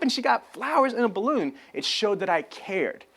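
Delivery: ordinary delivery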